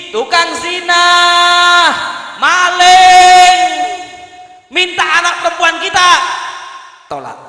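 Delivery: loud, emphatic sermon delivery; fiery preaching. Acted. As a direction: preach it forcefully with lifted voice.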